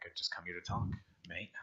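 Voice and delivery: quiet, casual aside; soft-spoken stranger. Acted. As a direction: murmur it calmly. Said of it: "that's what he says to you".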